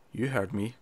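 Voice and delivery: quiet imitation of a soft-spoken man. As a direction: say it softly, no projection. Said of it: "a regular soft-spoken voice".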